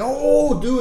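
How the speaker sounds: sudden high cry of shock; startled shout. Retold or imitated. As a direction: yelp as the car drops in. Falling into a pothole.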